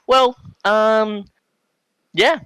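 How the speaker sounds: high, stalling mock-awkward reply; awkward hesitation. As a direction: drag out an awkward, unsure answer. Cops awkward after his smart reply.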